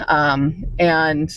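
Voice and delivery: ordinary delivery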